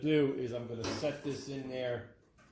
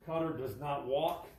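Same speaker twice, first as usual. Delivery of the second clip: ordinary talk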